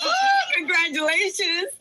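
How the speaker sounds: loud high whoop then congratulations; real excited celebration; excited cheer. Real, not acted. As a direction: cheer for the new daddy. Cheering a colleague's news.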